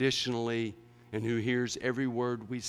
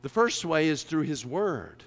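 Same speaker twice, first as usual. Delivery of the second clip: louder, driving sermon voice; full-force preaching; 7 semitones higher, 9 dB louder than usual. Real, not acted. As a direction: preach it hard to the congregation.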